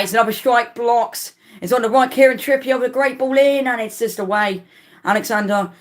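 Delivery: rapid-fire calling of each pass and block; excited play-by-play. Real, not acted. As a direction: fan narrating the Newcastle attack live, excited. Live play-by-play of an attack.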